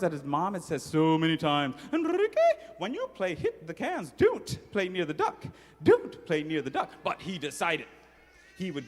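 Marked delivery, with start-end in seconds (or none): 1.9-6.9 high, insistent mother's warning; sing-song scolding mom; mom's warning voice. Retold or imitated. Mother warning her son about the duck, high and insistent.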